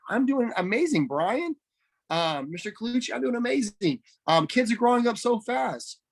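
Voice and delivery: excited demo of a friendly audio message. Shown as a demonstration. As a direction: sound thrilled to be catching up, pitch lifted. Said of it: "they can hear the excitement in your voice. Be excited".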